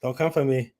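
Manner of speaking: ordinary talk, nothing marked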